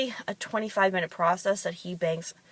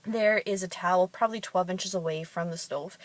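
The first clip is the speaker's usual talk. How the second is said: normal talking voice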